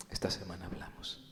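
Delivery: hushed promise, barely voiced; whispered aside. Believer quietly promising his brother a talk, whispered. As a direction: whisper it like a private confession. Telling a sister they'll talk.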